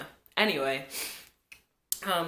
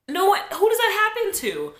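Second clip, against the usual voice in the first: indignant; 8 dB louder than usual. Real, not acted. Outraged retelling of the injury.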